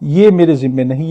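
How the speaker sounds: ordinary talk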